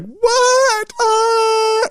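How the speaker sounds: put-on high shrieking little girl; mock child's shriek. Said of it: "She's like".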